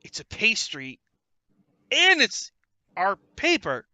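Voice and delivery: loud eager gnome salesman; excited gnome pitch. Acted. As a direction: pitch the deal like a pushy salesman. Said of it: "Gaxley's like".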